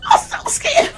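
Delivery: very high whispery terrified mock voice; mock scared squeal. Mocking a scared person, squeaky high panic. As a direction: ham it up like a frightened fool. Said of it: "They were scared like a".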